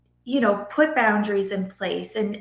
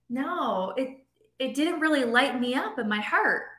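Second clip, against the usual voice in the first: usual speaking voice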